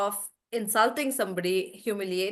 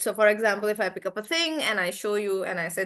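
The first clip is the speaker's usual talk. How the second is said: ordinary talk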